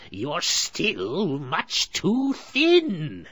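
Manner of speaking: high, very slow, loud whine; a greedy hag grumbling; complaining witch. A narrator's reading. Witch feeling the bone, slow and grumbling. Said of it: "she complained".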